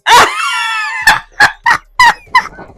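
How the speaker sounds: long very high cackling laugh. Real, not acted. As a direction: let a long laugh roll out. Laughing about reparations comment.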